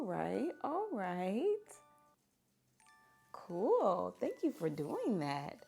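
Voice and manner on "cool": ordinary delivery, nothing marked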